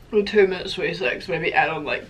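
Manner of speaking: ordinary talk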